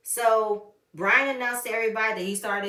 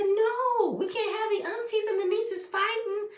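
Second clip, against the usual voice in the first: very high, wailing protest; mock plea; 13 semitones higher, slower than usual. Reacting to aunties and nieces fighting, high mock pleading. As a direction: beg in a hammed-up squeal.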